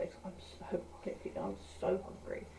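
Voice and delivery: hungry whisper. Real, not acted. Whispering to the camera that she's starving. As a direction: breathe it out like a secret.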